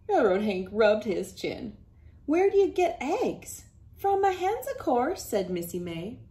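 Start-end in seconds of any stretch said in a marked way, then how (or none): 2.3-3.7 higher, puzzled train driver; puzzled question. Acted. Hank asks puzzled. 4.0-5.2 higher, obvious-answer tone; matter-of-fact farm girl. Acted. Missy May answering.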